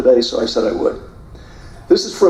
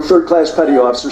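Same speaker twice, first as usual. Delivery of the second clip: ordinary talk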